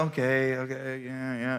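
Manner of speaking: low, softened mumbled okays; meek acceptance. Retold or imitated. Boy being made fun of by two guys, meek. Meekly takes the teasing.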